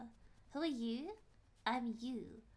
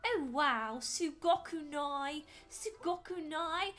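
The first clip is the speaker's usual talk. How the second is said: slow, louder put-on Japanese voice; Japanese performance voice; 4 semitones higher, 5 dB louder, slower than usual. Shown as a demonstration. Streamer doing a paid minute of Japanese, exaggerated delivery. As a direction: draw out the Japanese for show. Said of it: "Alright, one minute on the clock".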